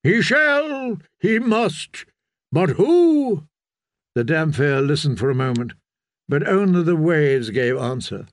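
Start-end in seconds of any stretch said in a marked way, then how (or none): 0.0-3.4 high-pitched, urgent responses; fervent crowd cries. A narrator's reading. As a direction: separate cries from a congregation. Followers answer the preacher's call.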